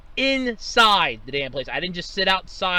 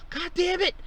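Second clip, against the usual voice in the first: higher, after a sigh, fed-up damn it; exasperated curse; 11 semitones higher, 4 dB quieter than usual. Retold or imitated. Driver sighing at the closed pumps, higher exasperated curse. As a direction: sigh then curse in defeat. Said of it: "And I'm like".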